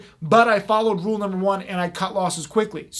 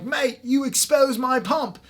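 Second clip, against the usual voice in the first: put-on slow, higher, loosely British or Australian accent; British-ish accent; 4 semitones higher, slower than usual. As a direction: imitate a British promoter, lightly and slowly. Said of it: "he was British or Australian or something".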